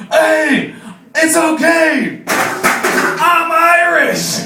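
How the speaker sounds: Irish guy justifying the drinking, loud proud mock shout; mock Irish boast. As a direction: belt out the excuse like a proud drunk.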